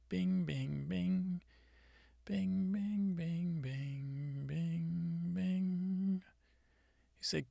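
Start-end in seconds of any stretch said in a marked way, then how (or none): 0.1-6.2 high, sing-song piano bings; mock the piano notes in a high voice. Mimicking a zombie song's piano.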